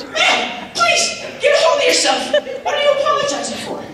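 high, laughing disbelief. Real, not acted. Clerk cracks up at the customer's confession, laughing high.

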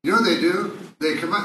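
usual speaking voice

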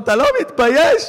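an offended wife wailing at her husband; hammed-up weeping outburst; crying wife. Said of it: "היא מתחילה לבכות".

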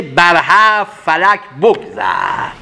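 very slow, lingering verse; slow poem recitation. Acted. Teacher chanting Rumi to listeners, very slow. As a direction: let the verse ring out slowly.

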